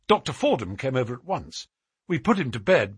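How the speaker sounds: ordinary delivery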